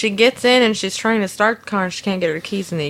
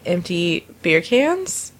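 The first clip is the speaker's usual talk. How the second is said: normal talking voice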